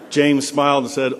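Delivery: ordinary delivery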